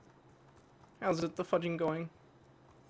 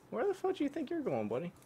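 higher, teasing taunt; mock high taunt; 7 semitones higher, 5 dB quieter, faster than usual. Gamer taunting an enemy player who runs away. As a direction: sneer at the fleeing enemy in a raised voice.

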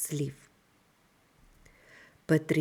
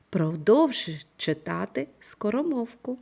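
ordinary talk

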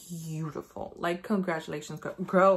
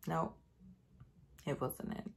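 whispered letdown; 4 semitones lower, 13 dB quieter than usual. Real, not acted. Disappointed aside.

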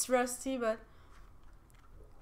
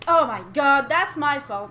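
loud rush of self-blame; loud dismay; 9 dB louder than usual. Real, not acted. Player blaming herself before her teammates, loud dismay. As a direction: blurt it out loudly, embarrassed. Apologising after mistake.

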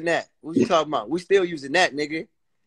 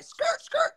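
imitated tire screech; 6 dB quieter than usual. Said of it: "He was like".

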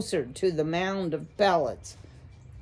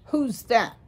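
sharp, louder question; demanding; 5 semitones higher, 4 dB louder than usual. Acted. An owl spotting a stranger, sharp demand. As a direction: owl suddenly demanding who it is. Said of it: "he demanded".

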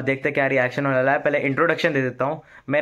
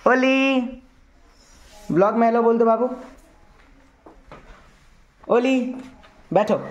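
calling the dog; 8 semitones higher than usual. Real, not acted. Calling the sleeping pet dog.

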